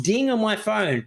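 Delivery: usual speaking voice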